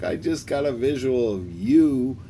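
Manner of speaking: giggling rejection, laugh breaking in; laughing at him. Retold or imitated. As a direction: tease him while cracking up. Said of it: "she starts laughing at him".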